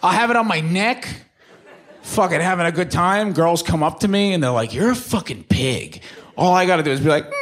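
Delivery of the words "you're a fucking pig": slow, lightly voiced scornful girl; a hint of a disgusted girl sneering; mock insult. Girls at the bar insult him, mild sneer.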